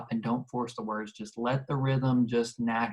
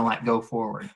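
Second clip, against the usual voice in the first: usual speaking voice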